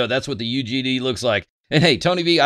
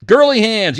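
mock jab; 9 semitones higher, 8 dB louder than usual. Real, not acted. Mocking a commenter's remark.

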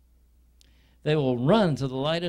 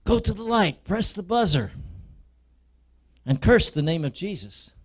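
higher, louder urging, not whispered; tempting Satan; 6 semitones higher, 5 dB louder than usual. Acted. Satan tempts the prisoner, higher and louder. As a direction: push them toward the buzzer like a tempter. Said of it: "will be whispering in your ear".

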